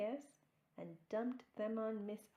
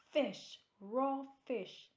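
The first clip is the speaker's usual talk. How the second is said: higher, loud disgusted cry; angry outcry; 6 semitones higher, 6 dB louder, slower than usual. A narrator's reading. Guest finding raw fish in the cake, angry yell. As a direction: spit it out, appalled. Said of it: "Missus Ralph was angry".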